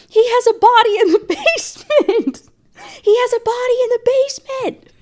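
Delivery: very high, loud squeaky blurt; mock shrill accusation. Girlfriend blurts to the police, high squeaky shout. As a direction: shriek it out like a hysterical girlfriend.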